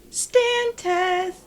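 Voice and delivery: high, drawn-out announcer call; game-show announcement. Acted. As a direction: sing out the segment name, stretched. Announces a recurring bit.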